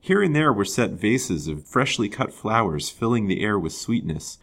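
ordinary delivery, nothing marked

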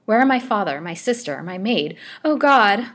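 high, loud distressed cry; building to a shriek. A narrator's reading. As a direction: distress building to an anguished outcry. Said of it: "a piercing shriek burst from her lips".

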